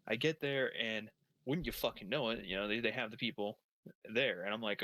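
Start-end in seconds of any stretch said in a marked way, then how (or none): none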